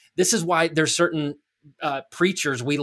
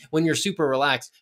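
normal talking voice

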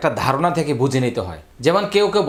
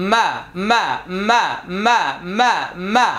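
counting beats; 4 semitones higher, slower than usual. Shown as a demonstration. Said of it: "ছয়টি হরকতের উচ্চারণ".

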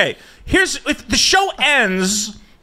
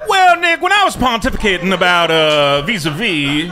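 mock YouTuber. Host mimics a commentator addressing Nick, mock pontificating. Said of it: "he sounds exactly like that".

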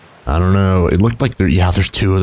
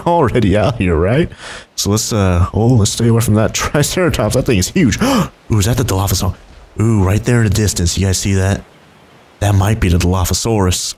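ordinary talk

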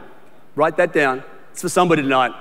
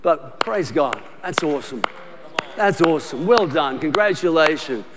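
softer, lower, genuine praise; sincere congratulations; 5 semitones lower, 5 dB quieter than usual. Retold or imitated. Pastor models applauding others' success, softer and sincere. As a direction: applaud them warmly and softly. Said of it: "Not look at them and go".

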